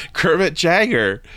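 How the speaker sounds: high, drawn-out disbelief; incredulous bank teller. Acted. Teller reacts to absurd name.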